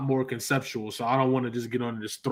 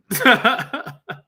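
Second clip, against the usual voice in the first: high burst of laughter; 10 semitones higher, 6 dB louder than usual. Real, not acted. Laughs at own bio remark.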